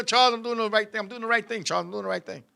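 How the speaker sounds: soft, repeated, fading aside; hushed self-assurance. Retold or imitated. Young lover telling Charles he's doing right, hushed. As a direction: murmur it to reassure yourself, trailing away. Aside of himself mid-act, proud.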